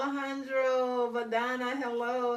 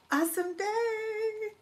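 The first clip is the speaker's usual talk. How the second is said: excited sign-off; 12 semitones higher, slower than usual. Real, not acted. Signing off cheerfully.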